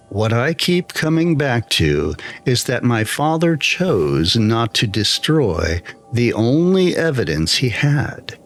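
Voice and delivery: plain reading voice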